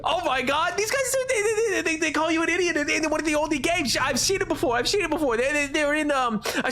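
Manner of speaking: overjoyed high-pitched outburst; real excitement. Real, not acted. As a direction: yell like you just spotted your favorite thing. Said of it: "I got really excited".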